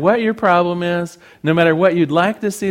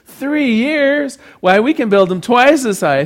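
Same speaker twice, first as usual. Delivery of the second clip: high-pitched mock disbelief, louder; incredulous boast; 8 semitones higher, 5 dB louder than usual. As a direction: scoff like a bragging Texan in a joke. Joke's Texan scoffs at cabbie's answer.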